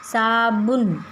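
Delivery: साबुन said very slowly, ऊ held long; teacher drawing out the vowel; slow sounding-out. Shown as a demonstration. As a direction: stretch साबुन for the class. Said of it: "कौन सी ऊ की मात्रा आएगी?".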